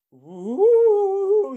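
high, loud ghostly howl; ghostly woo. Acted. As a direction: wail a long spooky woo.

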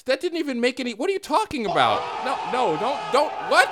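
incredulous laughing shouts; baffled outrage. Real, not acted. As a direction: protest the insult in disbelief. Baffled by the rap jab.

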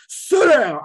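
sudden loud, high-pitched command; stern mommy voice. Shown as a demonstration. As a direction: bark it like a mom who means business. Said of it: "I'm gonna put my mommy voice on".

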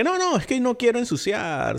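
high, put-on childish whine; a hammed-up high kid's excuse; mock kid voice. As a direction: squeak it out like a fibbing kid.

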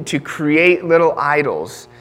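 usual speaking voice

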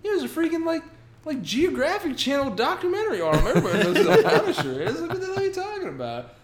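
high, loud, half-laughing mock exasperation; incredulous outburst. Defender to Jessica about the famous Punisher, exasperated mock rant. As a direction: rant in disbelief, pitch way up. Incredulous that she doesn't know.

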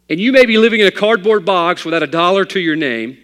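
loud and high, driving the point home; preaching shout. Real, not acted. Preacher to his flock, hitting the climax loud. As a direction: lift it into a full-force preaching cry.

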